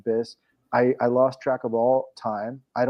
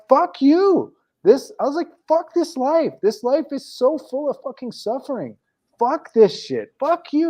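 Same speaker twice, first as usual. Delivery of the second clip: raging outburst; 14 semitones higher than usual. Retold or imitated. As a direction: scream the curses up high like you're breaking open. Retold outburst cursing at God.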